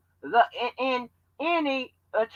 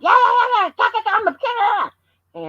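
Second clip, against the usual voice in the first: high, loud hyped-up bragging; high shouted brag; hyped boast; 11 semitones higher, 6 dB louder than usual. Retold or imitated. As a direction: pump yourself up, shouting. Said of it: "I be like".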